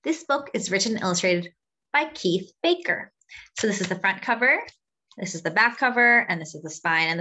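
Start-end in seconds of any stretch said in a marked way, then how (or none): none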